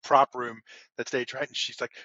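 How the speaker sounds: usual speaking voice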